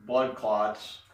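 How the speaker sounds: ordinary delivery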